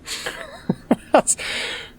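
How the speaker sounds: breathy, high burst of laughter; real laugh. Real, not acted. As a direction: crack up at the game. Streamer reacts to the game.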